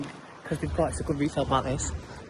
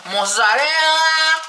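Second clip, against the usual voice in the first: even higher and louder, very slow put-on Italian-ish voice; a louder, hammed-up mock Italian chef; funny voice; 11 semitones higher, 9 dB louder, much slower than usual. Real, not acted. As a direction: ham it up like a TV chef. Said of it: "Why am I talking like that?".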